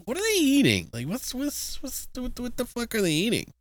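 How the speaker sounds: high, rising disbelief; can't believe what they're eating; freaked-out disbelief. Retold or imitated. Watching the dinner scene, high alarmed disbelief. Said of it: "And I'm like".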